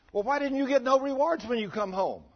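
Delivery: very high, loud, incredulous questioning; a friend in heaven baffled by the missing crowns, high and loud; astonished friend. Retold or imitated. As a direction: call out to him like a shocked old friend. Friend presses Howard again.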